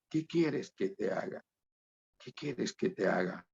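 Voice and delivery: hushed, reflective repeat of a question; soft quoted question. Retold or imitated. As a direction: drop the voice and let the question sink in.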